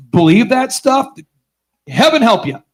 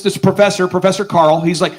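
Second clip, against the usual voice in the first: ordinary talk, nothing marked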